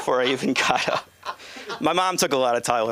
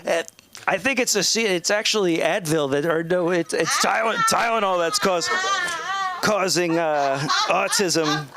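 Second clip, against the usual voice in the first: lightly mocked politician, higher-pitched and fumbling; Donald Trump; 7 semitones higher than usual. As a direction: stumble through a mock announcement, pitched up. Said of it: "Trump ... gets up and he's like".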